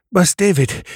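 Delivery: high, loud, breathless call; panting. A narrator's reading. Tembela slipping into the warehouse with news, panting. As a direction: call the boss while catching your breath. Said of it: "she panted".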